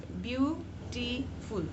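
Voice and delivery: Beautiful slowly as view, टी, फुल; syllable by syllable. Shown as a demonstration. Teacher demonstrating three syllables, slowly syllable by syllable. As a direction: pull beautiful apart into three beats. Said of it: "मैं उच्चारण कर रही हूँ".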